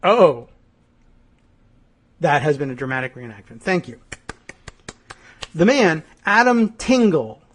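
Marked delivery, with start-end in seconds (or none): none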